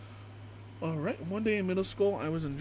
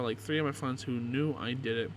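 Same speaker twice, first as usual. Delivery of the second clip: ordinary delivery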